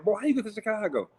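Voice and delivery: a frantic mother demanding answers; shocked mother. Retold or imitated. Mother on the phone shocked her son is in Chicago.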